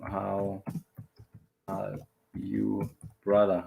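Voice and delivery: "How ... are you ... brother" with long breaks between parts; word by word. Shown as a demonstration. Teacher drilling a greeting for learners, word by word.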